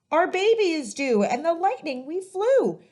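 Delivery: high, loud breathless rush; excited cry. Acted. New father telling of the dash to hospital, excited and loud. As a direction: ride the thrill of the race. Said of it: "cried a man".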